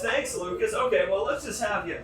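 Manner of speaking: usual speaking voice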